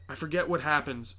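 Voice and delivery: normal talking voice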